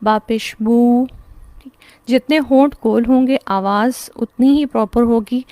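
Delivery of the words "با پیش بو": "بو" with lips well rounded, slow; teacher modelling the damma sound. Shown as a demonstration. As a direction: round the lips and draw out "با پیش بو". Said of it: "ہونٹوں کو اچھے سے گول کیا جائے".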